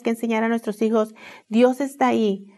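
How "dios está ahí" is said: usual speaking voice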